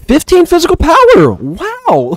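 high, loud incredulous cry; shouted disbelief. Real, not acted. Gamer reading the teammate's stats, shouting in disbelief. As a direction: exclaim the figure at top pitch.

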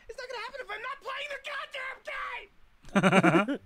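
fast rant that blows up into a yell on goddamn game; angry outburst. Acted. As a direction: explode at the end of the sentence. Gamer snaps at being interrupted.

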